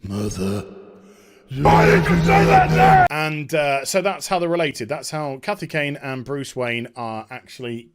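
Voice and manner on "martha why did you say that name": quoting Batman's Martha scene, a loud hammed-up shout; mock Batman shout. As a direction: ham up the Martha moment. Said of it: "quoting Batman v Superman".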